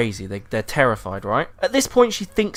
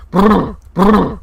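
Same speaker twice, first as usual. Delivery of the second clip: splashing brr; 7 semitones higher, 8 dB louder than usual. Retold or imitated. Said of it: "go brr".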